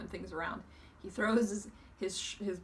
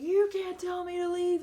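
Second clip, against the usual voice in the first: loud, high enraged shout; imitating a raging bum; furious yell; 10 semitones higher, 10 dB louder than usual. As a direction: scream it like a man losing it. Bum rampaging when told to leave.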